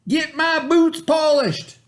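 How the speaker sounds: high, loud, slow demand; bossy shout. A narrator's reading. Stepbrother demanding his tie, shouting high. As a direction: bark the order at him. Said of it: "shouted Milton".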